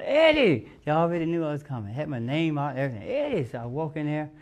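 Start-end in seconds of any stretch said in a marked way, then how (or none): 0.0-0.7 high loud call of a name; the stars hollering his name. Retold or imitated. 3.1-3.5 high call of a name; the stars hollering his name. Retold or imitated.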